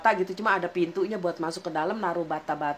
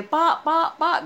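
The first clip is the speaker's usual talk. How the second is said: loud high call toward the house; shouting for the parents from the door; 9 semitones higher, 3 dB louder, slower than usual. Retold or imitated. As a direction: call for the parents across the yard. Calling parents from outside.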